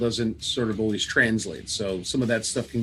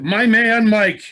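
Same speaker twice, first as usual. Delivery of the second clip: loud, high showman call; hype announcer; 9 semitones higher, 8 dB louder, slower than usual. Acted. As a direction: belt the name like a ring announcer. Mock hype intro.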